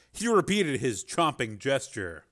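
ordinary delivery

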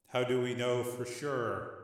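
soft, slow question; subdued doubt. Acted. Rick questions his brother's certainty, subdued. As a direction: wonder it quietly, unhurried.

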